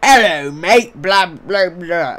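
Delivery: hammed-up British accent, blustering out. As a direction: caricature a brash British YouTuber. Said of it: "stereotype British YouTubers, like the".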